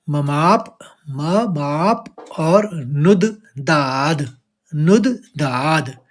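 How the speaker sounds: ममाप and नुद दाद spaced out slowly, stress on each part; slow stressed syllables. Shown as a demonstration. Teacher showing students the stressed syllables of ममाप्नुद्दाद्. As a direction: break नुद दाद apart and lean on it. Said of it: "आघात लेते हुए".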